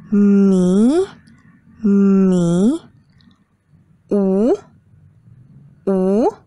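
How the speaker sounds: Ma, Ge, Mi, Wu each swept upward as if in doubt; rising second tone. Shown as a demonstration. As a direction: lift the pitch through Ma, Ge, Mi, Wu like a doubtful question. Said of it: "rising pitch, just like when you are in doubt".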